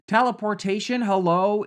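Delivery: high, loud incredulous outburst; exasperated exclamation. Real, not acted. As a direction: call it out as if it's obvious. Exasperated rant at the villain's choices.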